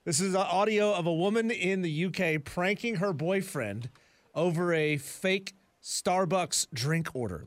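ordinary talk, nothing marked

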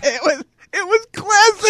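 high, squealing through laughter; laughing. Real, not acted. Host delighted by the press conference, laughing. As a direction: barely get it out while cracking up. Host cracking up over the clip.

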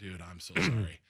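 low, soft retold apology; imitating a hushed condolence; feigned sympathy. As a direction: apologize gently, voice dropped. Retells Griffey's fake apology.

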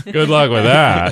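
slow sarcastic dismissal. Retold or imitated. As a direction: drag it out mockingly. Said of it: "they'd be like".

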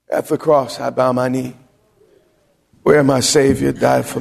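tender worship recitation. Acted.

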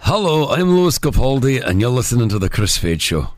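put-on passable copied Scottish accent; imitation Scottish accent, dropping low at the end. Host copying co-host's ident, approximate Scottish accent. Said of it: "I'm getting better at it".

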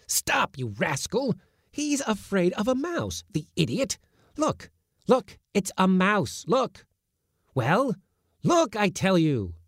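very high, exasperated scolding. A narrator's reading. Uncle scolding the kitten to look at the mouse, high. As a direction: berate the cowardly kitten.

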